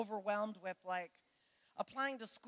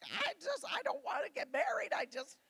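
put-on higher, whiny voice of a tearful thirteen-year-old; lightly voiced boy blubbering to the pastor; crying boy; 8 semitones higher, 5 dB louder than usual. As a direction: play the scared kid near tears, pleading. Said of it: "this one little thirteen-year-old boy started crying".

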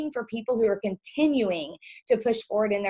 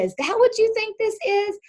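mock surprise; 12 semitones higher, 5 dB louder than usual. Coach answering an MLM objection, mock astonished. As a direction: pitch jumps way up in feigned shock. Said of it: "This is what I always say".

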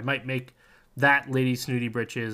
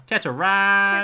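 loud, high, drawn-out Southern holler; Scooter; 9 semitones higher, 10 dB louder, slower than usual. Shown as a demonstration. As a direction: holler it in a hammed-up Southern accent. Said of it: "the character from Borderlands".

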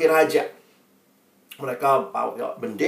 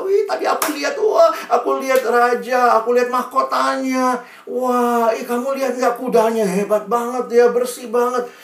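put-on high, loud childlike chatter; excited children; 9 semitones higher, 4 dB louder than usual. Children chatter on the way back, excited boasting. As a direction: gush like kids comparing what they saw. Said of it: "anak-anak cerita".

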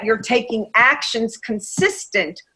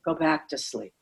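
lightly voiced inner brat, soft and coaxing, much softer; 5 dB quieter than usual. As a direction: coach voicing the inner brat coaxing you back to sleep, softly. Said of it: "The brat goes".

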